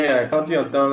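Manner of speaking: ordinary talk, nothing marked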